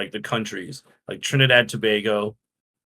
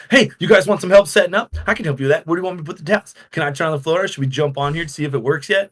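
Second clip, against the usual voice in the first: higher, eager helpful chatter; bubbly; 4 semitones higher, much faster than usual. Retold or imitated. New girl offers the tired staff help, bubbly. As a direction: offer help like an overeager newbie. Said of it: "I am just bubbly".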